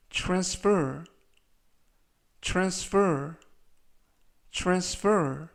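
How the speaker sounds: careful model of "transfer", both syllables clear; teacher's clear demo of "transfer"; pronunciation model. Shown as a demonstration. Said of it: "이라고 발음하시면 되겠고요".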